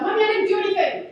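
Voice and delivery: high, loud imitation of a small child; child protesting innocence. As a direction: blurt it out like a guilty toddler. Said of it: "we haven't even talked about".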